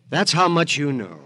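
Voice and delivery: bitter laughing retort. Acted. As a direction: laugh off the accusation.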